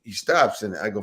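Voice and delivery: usual speaking voice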